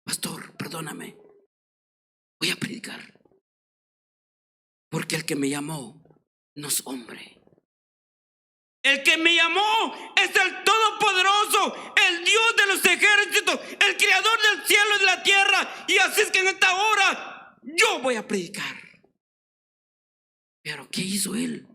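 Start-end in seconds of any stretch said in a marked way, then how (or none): 0.0-7.4 much softer, lower, hushed apology; humbled preacher quietly asking the pastor's forgiveness; humble whisper. Retold or imitated. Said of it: "le dice al pastor". 8.8-18.8 much higher, louder full-force sermon; shouting preacher proclaiming God's power; fiery preaching. Acted.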